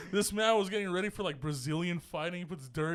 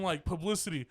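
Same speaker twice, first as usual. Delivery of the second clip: ordinary talk, nothing marked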